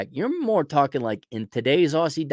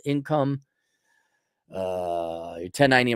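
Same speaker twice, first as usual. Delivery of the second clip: normal talking voice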